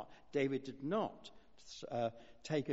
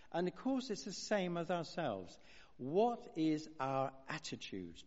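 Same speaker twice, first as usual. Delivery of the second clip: normal talking voice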